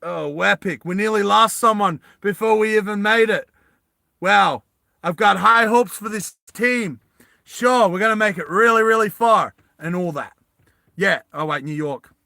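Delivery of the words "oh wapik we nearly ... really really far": louder, higher American voice; American accent. Acted. Vash's character jokes after nearly losing someone, American accent. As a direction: put on a loud American accent. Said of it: "you have American accent as well".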